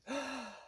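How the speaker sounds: sharp high excited gasp; imitated gasp of sudden recall. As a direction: gasp as if remembering something big. Friend gasps, remembering a story.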